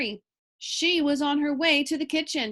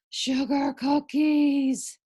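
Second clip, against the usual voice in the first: dreamy pig thought; 2 semitones higher, 4 dB louder, much slower than usual. A narrator's reading. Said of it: "thought Mercy".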